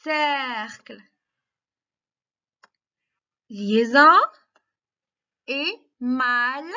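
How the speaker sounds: ordinary talk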